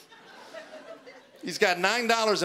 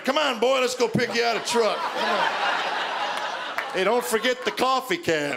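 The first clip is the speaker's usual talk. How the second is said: coaxing dad; 4 semitones higher than usual. Retold or imitated. Dad calling son to dealer.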